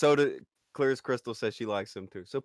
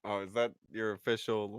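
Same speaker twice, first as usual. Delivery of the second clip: usual speaking voice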